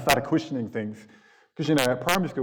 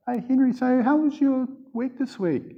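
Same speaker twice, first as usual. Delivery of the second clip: much higher, sing-song casual probing; breezy teacher; 13 semitones higher than usual. Retold or imitated. Teacher probing Henry about his home, falsely breezy. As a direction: act casual while fishing for clues.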